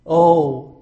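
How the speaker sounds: sighing oh. Acted. Voicing Joshua's wish.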